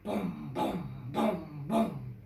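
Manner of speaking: deep punchy drum sounds; drum booms. Acted. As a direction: thump out each boom like a taiko drum. Taiko drums imitated.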